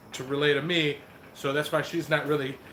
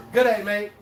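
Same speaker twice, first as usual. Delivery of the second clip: put-on loud, half-serious stab at an Australian accent; attempted Australian accent; 3 semitones higher, 7 dB louder than usual. Host copies Penny's accent for viewers, attempted Australian accent. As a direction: try an Australian greeting, punched out loudly. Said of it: "She just did an Australian accent".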